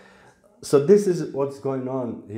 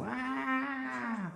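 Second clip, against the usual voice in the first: newborn cry; 13 semitones higher, 11 dB quieter than usual. Retold or imitated. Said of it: "you start crying".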